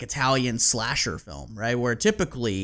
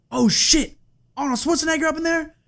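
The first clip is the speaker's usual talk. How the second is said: high, loud rapper impression; 5 dB louder than usual. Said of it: "probably a horrible T I impersonation".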